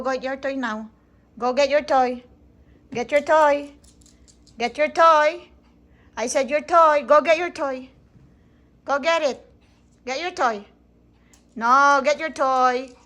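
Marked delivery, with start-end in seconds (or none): 1.4-10.6 firm, raised voice; real scolding insistence; insistent parental order. Real, not acted. Mother repeatedly orders child to fetch toy. 11.6-12.9 loud, slow, stretched-out order; stern, drawn-out no-nonsense command; firm parental command. Real, not acted. Mother overrules child, repeats the order.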